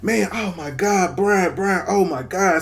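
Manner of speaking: imitating friends in a panic over him; freaking out. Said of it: "so they freaking the fuck out".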